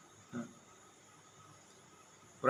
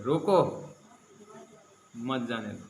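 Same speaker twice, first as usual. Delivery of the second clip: रोको drawn out, pause, then मत जाने दो together; comma after रोको; 2 semitones lower, slower than usual. Shown as a demonstration. Preacher showing how a comma changes meaning, pausing after रोको. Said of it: "कमा लगाओ".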